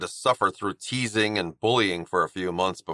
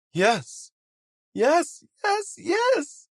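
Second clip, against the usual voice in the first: each yes climbing higher; ecstatic; much slower than usual. Acted. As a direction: burst out with rising joy. Cody's joyful acceptance of Roman.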